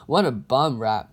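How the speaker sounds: ordinary delivery, nothing marked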